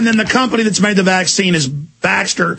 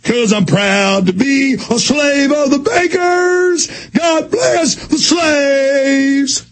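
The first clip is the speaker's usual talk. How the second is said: slow, higher, hammed-up shouting fool; mocking proud patriot; 7 semitones higher, much slower than usual. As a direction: sneer as the willing slave. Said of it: "and you'll go".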